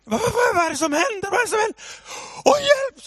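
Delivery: very high, frantic imitation of a wife; panicked wife. Wife finds daughter on the roof, imitated high panic. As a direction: play the alarmed wife, squealing in panic.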